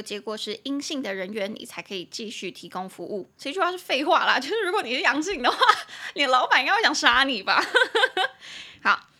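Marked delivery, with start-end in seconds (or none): 3.4-7.6 high, loud, laughing through the words; laughing at her own joke. Real, not acted. Joking that the boss would kill you. 7.7-8.8 a high, bright burst of laughter. Real, not acted. Laughs after her own joke.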